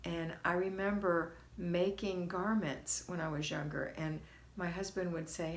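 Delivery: ordinary talk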